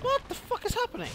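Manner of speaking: very high yelled question; shocked shout. Real, not acted. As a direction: shriek it in total confusion. Player shocked by game chaos.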